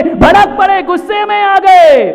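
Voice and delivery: high, loud outburst; thundering reproach. Real, not acted. Preacher reproaching husband for flaring up, shouted. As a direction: shout it as an accusation.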